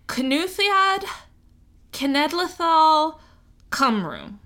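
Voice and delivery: "Cynulliad Cenedlaethol Cymru" said slowly, each word carefully sounded out in Welsh; careful Welsh, "Cynulliad" with a kuh-NITH-ee-ad sound; Welsh pronunciation. Shown as a demonstration. Podcaster trying the Welsh name for her listeners, slowly sounding it out. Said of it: "now in Welsh".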